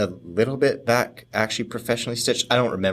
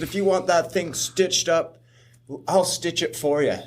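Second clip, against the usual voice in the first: high-pitched, put-on insistence; retold insistent offer; 8 semitones higher than usual. Telling his injured friend he'll stitch him, high insistent retelling. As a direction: act out the offer with voice pitched well up.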